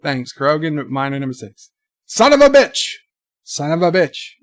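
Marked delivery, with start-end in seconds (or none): 2.1-2.9 loud, high shout; demonstrated shouted exclamation. Shown as a demonstration. Streamer showing one way to say it, shouting. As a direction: shout it out like a curse. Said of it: "how many different ways can you say".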